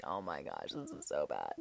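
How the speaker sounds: very low, barely voiced groaning words; muttered dread. Storyteller dreading her turn, low muttered dread.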